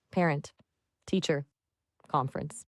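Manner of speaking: slow, word-by-word model phrase. Shown as a demonstration. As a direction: say each word separately, with pauses between. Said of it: "can you say it, um, slower?".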